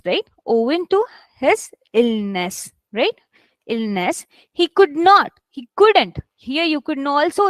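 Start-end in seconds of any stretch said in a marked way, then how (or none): 0.4-2.7 slow phrase, "illness" set apart and stressed. Shown as a demonstration. Teacher shows learners where stress falls, slow weight on "illness". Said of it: "your stress is on illness". 4.5-6.2 "could not" and "couldn't" punched higher and louder; stressed negative. Shown as a demonstration. Teacher shows learners to stress the negative, punching "not" and "couldn't". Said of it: "here you have to stress it".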